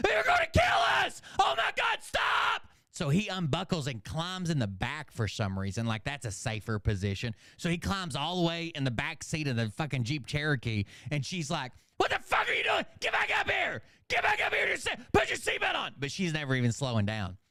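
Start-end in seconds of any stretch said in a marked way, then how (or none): 0.1-2.7 shrieked, very high-pitched mock panic; panicked screaming. Son panicking in speeding car. 12.0-16.0 very high, yelled mock tirade; furious screamed orders. Mom screaming orders at son.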